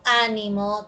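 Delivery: "ánimo" stressed on initial Á; stress on first syllable. Shown as a demonstration. Teacher contrasting accents for students, "ánimo" stressed first. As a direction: hit the opening of "ánimo": Á-ni-mo. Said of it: "aquí observamos oposición del acento".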